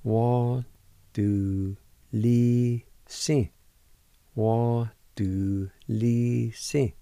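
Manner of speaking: du li si stretched out slowly, each syllable apart; slow pronunciation. Shown as a demonstration. Teacher to students learning Cherokee, slowly sounding out. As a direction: spell out Wa du li si very slowly. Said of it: "It's pronounced like this".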